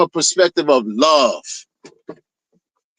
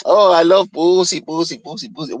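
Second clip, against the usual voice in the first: mocking his fan talk. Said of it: "who speaks like that?".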